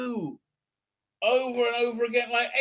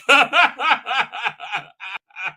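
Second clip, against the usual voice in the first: loud helpless laughter, nearly crying; big laugh; 3 semitones higher, 6 dB louder than usual. Real, not acted. Streamer to chat, real laughing fit. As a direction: burst out laughing at your own joke. Cracks up at own joke.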